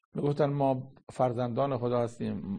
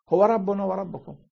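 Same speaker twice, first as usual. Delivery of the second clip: higher and louder, the verse flung out; ringing proclamation; 8 semitones higher, 6 dB louder than usual. Acted. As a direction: proclaim it with full preaching force. Preacher proclaims verse at full force.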